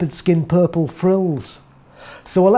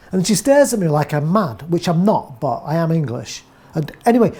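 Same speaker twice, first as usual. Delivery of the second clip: ordinary delivery, nothing marked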